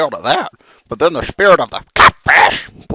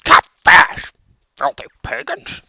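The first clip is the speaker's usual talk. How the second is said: hissed curse; slower than usual. Acted. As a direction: spit out the name. Frog spits enemy name.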